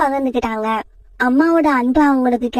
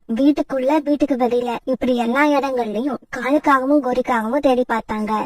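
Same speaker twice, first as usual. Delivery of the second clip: ordinary talk, nothing marked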